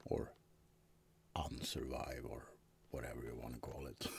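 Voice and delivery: soft joking whisper. Acted. Vampire whispering a joke to his roommate. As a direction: toss it off in a whisper. Vampire jokes about undeath.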